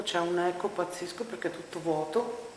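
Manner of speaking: ordinary talk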